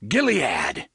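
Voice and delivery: loud rallying cry of Gilead!; battle cry. Acted. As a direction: shout Gilead! to charge. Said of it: "he cried".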